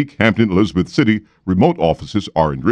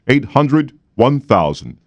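slow emphatic number; 6 semitones higher, much slower than usual. Shown as a demonstration. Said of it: "slow number, pitch lifted".

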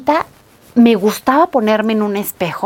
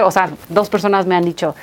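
ordinary talk

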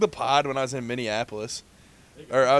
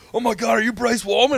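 high, louder starstruck gush; excited fan; 8 semitones higher, 5 dB louder than usual. Retold or imitated. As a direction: gush like a star-struck fan. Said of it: "She's like".

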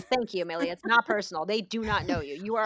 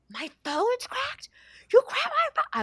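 much higher, slow, then loud; outraged mother; 5 dB louder, slower than usual. Retold or imitated. Mother finding her cracked phone, outraged. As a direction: build from disbelief to fury. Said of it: "I was so mad".

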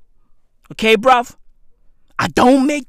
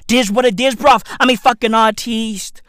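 ordinary talk

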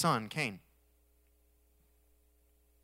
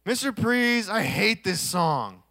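complaining student; 2 semitones higher, 5 dB louder, slower than usual. Retold or imitated. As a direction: drag out a pupil's complaint to the teacher. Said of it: "we used to say".

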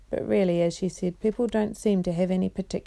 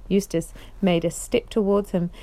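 ordinary delivery, nothing marked

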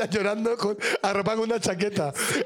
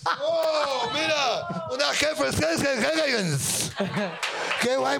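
excited; 6 semitones higher, much slower than usual. Real, not acted. Opening gift, excited cheer.

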